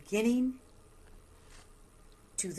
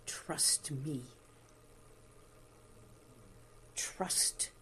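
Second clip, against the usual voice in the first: slow, hushed meditation voice; guided meditation; slower than usual. Acted. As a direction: breathe the words out gently.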